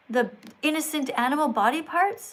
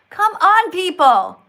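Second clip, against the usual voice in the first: loud, exasperated appeal; exasperated shout; 3 semitones higher, 10 dB louder, slower than usual. Real, not acted. Streamer berating religious viewers, exasperated and loud. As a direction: burst out loudly at the whole audience.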